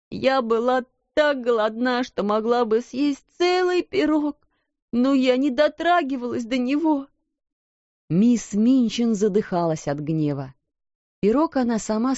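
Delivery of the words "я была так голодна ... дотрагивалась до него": tearful. A narrator's reading. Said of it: "с плачем уверяла Бекки".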